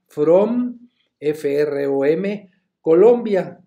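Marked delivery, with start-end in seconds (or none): 0.1-0.9 "from" said high and loud; teacher highlighting the word "from"; stress on from. Shown as a demonstration. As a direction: punch "from" up high. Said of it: "escribir dos palabras".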